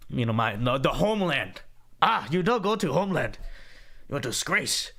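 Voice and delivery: lightly put-on Vietnamese accent; mock Vietnamese accent. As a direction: imitate a disapproving elder with a rough Vietnamese accent. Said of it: "I cannot do a Vietnamese accent".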